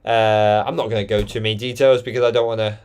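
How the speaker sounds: ordinary talk, nothing marked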